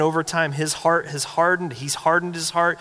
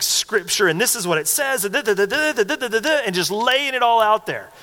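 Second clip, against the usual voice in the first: higher-pitched imitation of a preacher driving it home; imitated emphatic arguing, pitched up; nailing the point; 6 semitones higher than usual. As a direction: rattle off proof like an emphatic preacher. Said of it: "he's nailing it".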